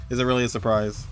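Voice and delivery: ordinary delivery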